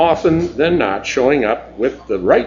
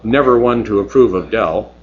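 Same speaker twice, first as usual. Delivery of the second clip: ordinary delivery, nothing marked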